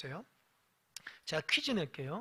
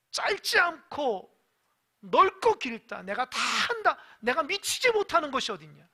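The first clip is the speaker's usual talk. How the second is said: God angry; 4 semitones higher, 5 dB louder than usual. Acted. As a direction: thunder back at the doubter. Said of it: "하나님 화가 났어요".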